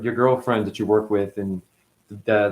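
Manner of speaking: ordinary talk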